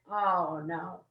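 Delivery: low, dropping mock dread; a low, sinking oh no of mock doom; dawning dread. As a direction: let the oh no sink very low and fade. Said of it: "And I went".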